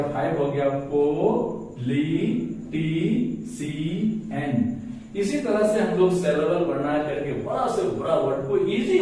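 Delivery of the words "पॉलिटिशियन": slow "पॉलिटिशियन", each syllable drawn out; five separate syllables. Shown as a demonstration. Teacher confirming five syllables for learners, slowly separated. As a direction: count out "पॉलिटिशियन" in five long beats. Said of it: "syllable भी पांच हो गया".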